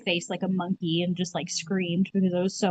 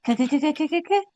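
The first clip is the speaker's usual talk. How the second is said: cracking noise imitation; 7 semitones higher than usual.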